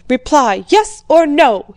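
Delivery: put-on an old prince shouting at his daughter to answer; shouted demand. A narrator's reading. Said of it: "he shouted".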